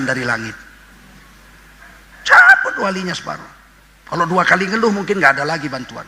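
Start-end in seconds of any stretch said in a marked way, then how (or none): 2.3-3.5 stern proclamation. Acted. Stern divine decree, voice shifts.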